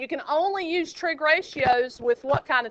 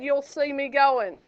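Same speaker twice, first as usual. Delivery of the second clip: normal talking voice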